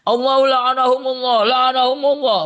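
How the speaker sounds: imitating a Shia curse, mocking. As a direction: parody their words. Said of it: "mereka ngomongnya bukan radhiyallahu anhu".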